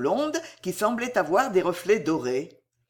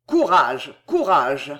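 lower, emphatic repeated word; urging encouragement; 5 semitones lower, 5 dB louder, slower than usual. A narrator's reading. Officer to dying child, firm encouragement. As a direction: press courage on the wounded.

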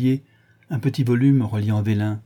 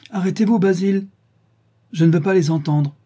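higher-pitched protest; urgent, raised-pitch outburst; crying out; 7 semitones higher, 4 dB louder than usual. A narrator's reading. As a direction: cry out to cut him off. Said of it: "s'écria Dorian en se levant".

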